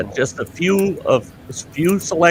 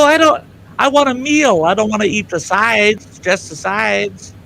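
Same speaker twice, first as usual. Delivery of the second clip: exasperated; 7 semitones higher than usual. Retold or imitated. Customer protesting to KFC clerk, high exasperated whine. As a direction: pitch it up in mock outrage.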